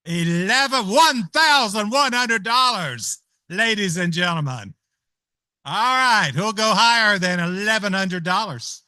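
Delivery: loud, drawn-out going once, going twice, sold; auctioneer closing the sale. Acted. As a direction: close the auction with a flourish.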